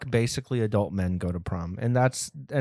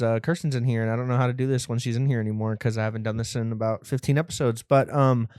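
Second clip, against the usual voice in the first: usual speaking voice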